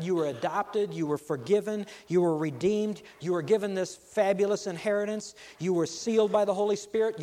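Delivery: preacher building to full force; building sermon climax. Acted. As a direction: pile it on, each line bigger.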